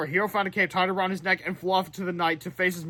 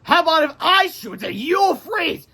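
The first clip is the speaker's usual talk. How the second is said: much higher, slow and mocking; taunting villain; 12 semitones higher, 5 dB louder, slower than usual. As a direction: toss the joke back like a cocky villain.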